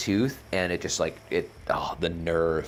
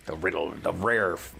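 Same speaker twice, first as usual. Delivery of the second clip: pirate voice; 4 semitones lower than usual. Pretend. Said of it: "all pirate voices start with Gar".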